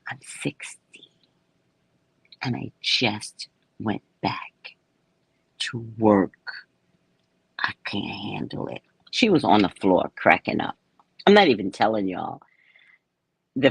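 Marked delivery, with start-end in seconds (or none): 0.1-8.8 retold whisper. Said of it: "I was like".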